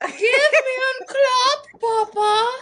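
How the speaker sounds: mock German accent, slowed and drawn out; hammed-up German-accented come-on. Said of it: "Why you got a German accent?".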